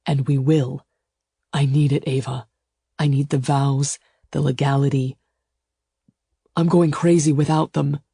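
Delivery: firm insistence in short phrases; insist, one weighted phrase after another; emphatic. A narrator's reading. Suitor explains his need for vows, emphatic. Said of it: "he said emphatically".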